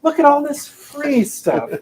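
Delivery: high, slow cheesy sales voice; hammed-up pitchman; mock ad pitch. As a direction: sell it like a cheap ad.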